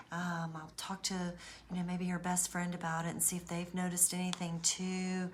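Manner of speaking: soft, hushed, mock worried voice; fake concern. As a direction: murmur it like a false confidant. Abuser faking concern to mother.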